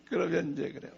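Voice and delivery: usual speaking voice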